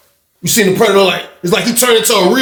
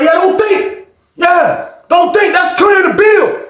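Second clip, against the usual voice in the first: angry shout; 13 semitones higher, slower than usual. Retold or imitated. Old head turning disrespectful.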